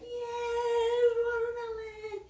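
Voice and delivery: put-on very high, drawn-out childish squeal; acted squeal of delight; ecstatic. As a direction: cheer like an overjoyed little kid. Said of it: "He was ecstatic".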